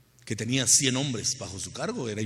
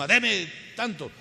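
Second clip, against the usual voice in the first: slow, higher, bossy demand; imposing demand; 8 semitones higher, slower than usual. Retold or imitated. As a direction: order it, don't ask. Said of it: "No digan con imposición". (exigencia lenta y más aguda, con imposición)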